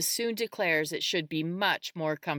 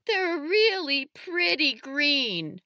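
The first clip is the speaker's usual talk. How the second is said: high, drawn-out tearful wail; acted sob, pitched high and slow; sobbing child; 10 semitones higher, 4 dB louder, slower than usual. A narrator's reading. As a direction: whimper it slowly through tears. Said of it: "Malcolm says with a sob".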